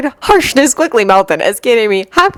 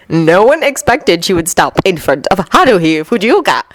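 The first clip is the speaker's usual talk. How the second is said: usual speaking voice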